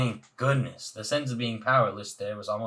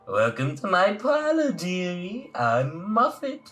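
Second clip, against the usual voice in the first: high, syrupy spider hostess voice; Muffet's welcome; 8 semitones higher, 3 dB louder, slower than usual. Acted. Muffet greets the traveler in her parlor, high and syrupy. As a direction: roll out the welcome like a sticky web, slow on the name.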